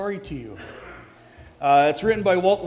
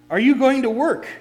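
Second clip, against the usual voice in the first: calling out; 6 semitones higher, 6 dB louder than usual. Acted. Ragman calls to a man.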